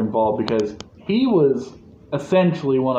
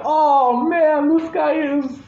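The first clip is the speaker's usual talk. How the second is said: high, drawn-out playful exclamation; amazed wincing reaction; 12 semitones higher, slower than usual. Retold or imitated. Fan reacting to Batman crashing into the bridge, high amazed exclamation. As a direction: exclaim it high in mock disbelief.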